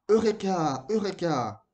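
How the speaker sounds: high, loud eureka shouts; triumphant cry. A narrator's reading. Moka dances at his sudden idea, triumphant cry. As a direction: call it out in triumph, stretching the end.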